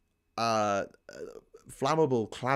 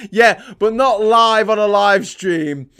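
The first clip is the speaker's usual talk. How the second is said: louder, higher, drawn-out retort; loud protest; 6 semitones higher, 13 dB louder, slower than usual. Real, not acted. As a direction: protest it loudly, stretching it out. Mocking exchange about a live mistake.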